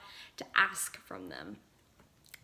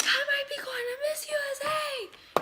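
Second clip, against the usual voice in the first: high, loud, tearful shriek; freaking out; 7 dB louder than usual. Retold or imitated. Crying daughter tells her dad the news, shrieking and tearful. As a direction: scream the news through tears. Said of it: "Freaking out".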